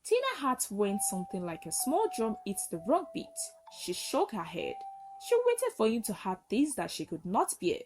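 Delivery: plain reading voice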